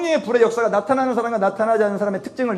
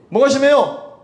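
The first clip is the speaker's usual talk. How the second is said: retold angry retort; 5 semitones higher, slower than usual. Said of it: "이 양반이 그렇게 막 소리를 지르니까".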